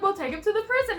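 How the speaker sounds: bumbling constable proposing a plan; acted suggestion. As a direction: offer it brightly, as if it solves everything. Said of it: "he was just like".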